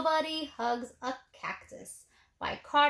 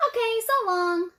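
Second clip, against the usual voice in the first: high, louder cheerful goodbye; 8 semitones higher, 4 dB louder than usual. A narrator's reading. Tumbleweed cheerfully saying goodbye to Hank. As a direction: breeze off with a sunny farewell. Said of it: "said Rosie cheerfully".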